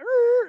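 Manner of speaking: high, loud wordless yell; excited yell. Retold or imitated. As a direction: let out a loud, high whoop of triumph. John pulls up yelling.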